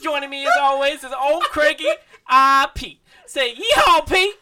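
high hollering country host; yeehaw cowboy voice. Pretend. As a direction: holler it like a rodeo announcer. Hammed-up cowboy podcast intro bit.

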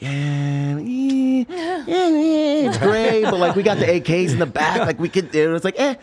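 high, loud, wavering "eh, eh"; hesitant inner voice. Retold or imitated. Soldier's conscience pulling back from shooting, high and wavering. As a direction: dither in a high strained voice. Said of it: "And it was like".

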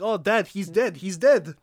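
high, loud hammed-up panic; mock alarmed cry. Acting Polish people.

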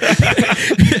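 high breathy laugh burst; burst of laughter. Real, not acted. Podcaster confessing to friends, bursting into laughter. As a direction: crack up mid-story.

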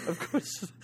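quiet whispered aside; whispering. Real, not acted. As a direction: hush it like a secret. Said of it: "Why are you whispering that?".

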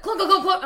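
high, loud run of clunks; imitated clunking. Telling how the cat jumps in, imitated clunking. Imitating the cat's weighted paw.

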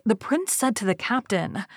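ordinary delivery

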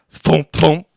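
loud, punched thump sounds; imitated falling-rock thumps. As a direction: mimic heavy rocks hitting the ground. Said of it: "And then I hear a thump".

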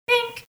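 a sharp high ding, like a bell; ping it out high; high bink sound effect. Retold or imitated. Podcast host mimicking a bell after each jab, high bink.

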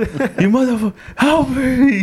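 high-pitched yelling plea; mock yell. Friend raging at [name] in Minecraft, high mock yell. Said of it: "He's just yelling at me".

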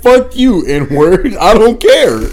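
loud, high, defiant outburst; defiant retort. Acted. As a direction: throw it out like you don't care. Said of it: "He's like".

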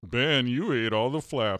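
slow, growly cartoon bear talking. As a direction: speak slowly as a grumbling bear. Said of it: "no, it was more".

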